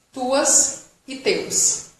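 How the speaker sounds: Tuas e teus spelled out slowly; slow model words. Shown as a demonstration. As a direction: drag it out for the class. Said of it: "Não pronuncia o S". (bem devagar, para repetirem)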